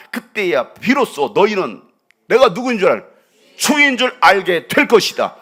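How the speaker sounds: loud preached declaration; preaching at full force. Acted. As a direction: thunder God's promise to the bones. Forceful scripture proclamation.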